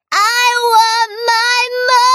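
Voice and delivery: high, sing-song howl; howling little princess. A narrator's reading. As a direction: howl it like a small girl. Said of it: "howled the little princess".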